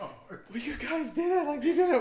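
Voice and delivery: high, laughing burst of amazed praise; thrilled praise. Retold or imitated. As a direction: gush at them, half laughing with relief. Friend voiced, thrilled they succeeded.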